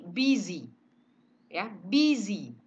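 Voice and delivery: busy with short clipped i sounds; short i in busy, said deliberately; teacher modelling busy; model word busy. Shown as a demonstration. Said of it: "karena I-nya pendek semua ini".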